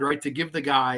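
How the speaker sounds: usual speaking voice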